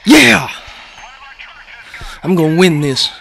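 loud, high victory yell; excited shout. Real, not acted. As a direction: cheer like you just won. Gamer cheering.

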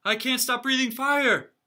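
loud, high panicked cry. Acted. Dragon father panicking, crying out loud and high. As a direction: blurt it out in alarm. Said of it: "Crispin's father panicked".

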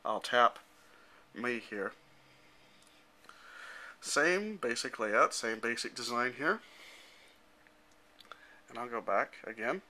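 ordinary delivery

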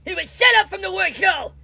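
defensive shouting. Acted. Pelosi to the reporter, an angry shout.

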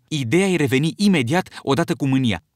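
ordinary delivery

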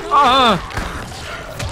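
loud, high, long cry; high yell of alarm mid-game; startled scream. Real, not acted. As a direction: scream as if suddenly grabbed. Attacked during zombie game.

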